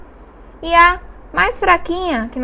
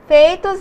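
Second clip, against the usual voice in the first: Feitos said out loud, rising, to show the diphthong; teacher's model word; 4 semitones higher, 6 dB louder than usual. Shown as a demonstration. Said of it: "eu tenho aqui duas vogais". (palavra Feitos dita em voz alta, subindo)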